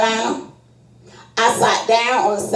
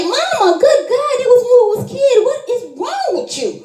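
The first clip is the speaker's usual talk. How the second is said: exasperated yell; 14 semitones higher than usual. Retold or imitated. Son pleading with his raging mother, high exasperated yelling. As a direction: throw it at his mother in disbelief.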